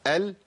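lam said for students to copy; demonstrated lam. Shown as a demonstration. Teacher has students try the lam, demonstrated lam. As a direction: model the letter lam clearly. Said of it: "جربوا كده، قولوا".

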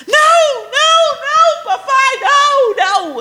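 put-on high, loud whiny child begging daddy; girl begging her dad not to take her to the room, whining high; child's dramatic pleading. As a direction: play the little girl wailing no. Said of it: "Aquele drama".

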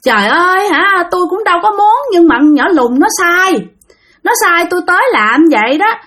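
high, gentle ghost voice with a hint of tears; a spirit lamenting the family hatred; softened spirit voice. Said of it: "vong này nhẹ giọng nói".

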